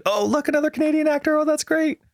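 high, gushing fan voice; mock excited. Joking with his wife, a high mock-excited gush. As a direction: squeal over a Canadian actor in jest. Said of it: "my wife and I have this joke".

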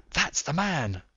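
high, breath-caught exclamation; gasped. A narrator's reading. Watson to Holmes as the cyclist appears, gasping. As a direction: gasp it in sudden recognition. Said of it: "I gasped".

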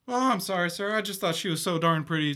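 put-on high, loud boyish apology; flustered little-boy voice; apologetic young boy. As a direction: blurt an excuse like a scolded lad. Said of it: "young, young boy".

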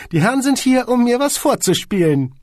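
high, cold and curt; icy squeaky boss. A narrator's reading. Boss tells his secretary off, high and icy. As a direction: freeze her out in a tiny high voice.

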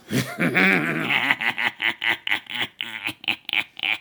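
low creepy villain laugh; evil snicker. Acted. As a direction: cackle quietly with menace. Villain laugh after threat.

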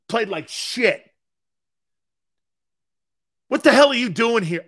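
loud, rising tirade that ends in a yell; angry coach rant. Retold or imitated. Buddy Ryan berating the player over last night's game, loud and angry. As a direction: tear into him like a furious coach.

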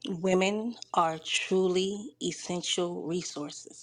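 slow, deliberate, each word set apart; slow recitation. Shown as a demonstration. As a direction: spell out the acronym slowly, word by word. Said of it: "say that water one again".

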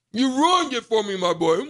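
ordinary talk

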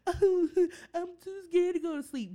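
put-on scared kid voice.

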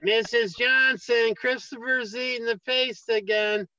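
high, slow sing-song tattletale; tattling kid. Retold or imitated. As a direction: whine it to the teacher like a kid.

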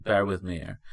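normal talking voice

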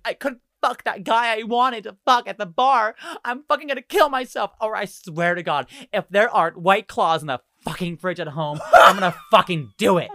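high, loud mock tirade; hammed-up hysterical rant; drunken ranting. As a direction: wail it like a melodramatic drunk passenger. Rider rants in drunken outburst.

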